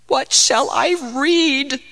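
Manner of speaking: high, loud, slow desperate cry. Acted. Squeezed man begs the voice to answer, crying out. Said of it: "cried the man".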